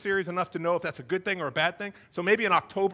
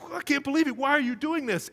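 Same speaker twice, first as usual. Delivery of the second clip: acted exasperated outburst, pitched up; high, mock-furious questioning; mad; 7 semitones higher, 3 dB louder than usual. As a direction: play an angry master scolding. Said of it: "he gets mad".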